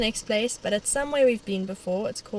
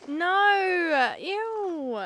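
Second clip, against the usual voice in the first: disgusted shout; 8 semitones higher than usual. Real, not acted. Reacting to poo joke.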